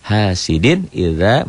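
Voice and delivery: "idza" with the a held two beats; mad tabi'i. Shown as a demonstration. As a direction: recite slowly and lengthen "idza". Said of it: "Dibaca panjang satu alif". ("idza" panjang dua ketukan)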